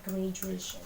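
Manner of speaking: softly modelled "Graduation", DU as J; pronunciation demo. Shown as a demonstration. Teacher shows how it sounds, soft "Graduation" with J. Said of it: "Kedengarannya kayak J".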